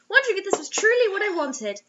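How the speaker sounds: ordinary talk, nothing marked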